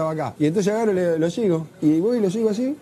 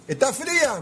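imitated shouted question; 7 semitones higher, slower than usual. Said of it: "me grita el Diego".

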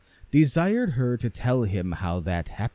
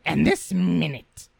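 commanding mother; 6 semitones higher than usual. Acted. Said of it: "said the mother".

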